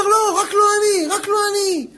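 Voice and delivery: high-pitched, urgent plea to be spared; pleading refusal. Acted. Moses begging God not to pick him, pleading refusal.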